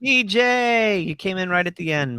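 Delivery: loud, high-pitched shout-out; projected, stretched-out hello; calling out a greeting. Real, not acted. Host calls out to late arrival.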